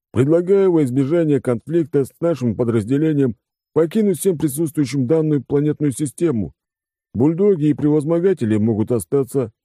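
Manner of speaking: голос по связи, официальный и угрожающий; зачитай ультиматум по рации; холодный ультиматум. A narrator's reading. Mercenary deputy broadcasts ultimatum. (холодный официальный ультиматум)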